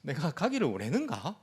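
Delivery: half-laughing pretend grievance; mock hurt disbelief. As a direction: think aloud as if slightly let down. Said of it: "아, 좀 서운한 마음".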